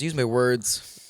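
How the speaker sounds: usual speaking voice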